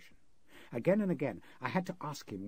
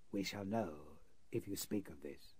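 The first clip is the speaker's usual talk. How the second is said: softer, slow, cold warning; slow quiet menace; 4 semitones lower, 6 dB quieter than usual. Acted. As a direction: let the threat sink in. Sinister man repeats his threat.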